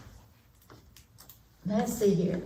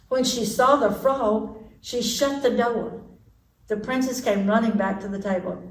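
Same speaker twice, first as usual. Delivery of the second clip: plain reading voice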